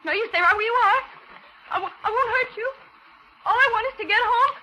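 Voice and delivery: high, rising panicky warning; frightened threat. Acted. Captive woman warning her kidnapper off, high and frightened. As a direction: keep him back with the gun, terrified. Holding gun on her captor.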